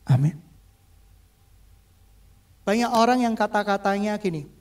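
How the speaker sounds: usual speaking voice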